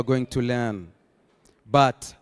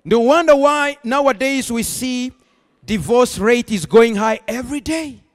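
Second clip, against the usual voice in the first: raised, forceful preacher delivery; emphatic preaching; 7 semitones higher, 5 dB louder than usual. Acted. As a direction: drive each point home to the congregation.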